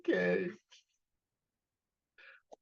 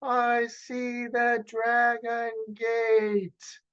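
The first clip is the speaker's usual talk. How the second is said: yelling; 8 semitones higher, much slower than usual. Acted. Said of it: "Bo yelled".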